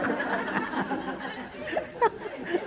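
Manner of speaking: long high laugh; hearty laugh. Real, not acted. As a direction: burst out laughing at the joke. Cười sau câu đùa về bà Tám. (cười phá lên)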